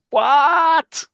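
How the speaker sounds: high, very loud drawn-out shriek; hammed-up shout of disbelief; outraged disbelief. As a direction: explode in cartoon shock. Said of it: "I think he said it like that".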